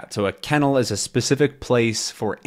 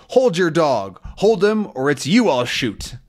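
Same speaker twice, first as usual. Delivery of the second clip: acted threat, starting raised in pitch; threatening; 6 semitones higher than usual. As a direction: warn them with mild menace. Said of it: "that's more threatening to them".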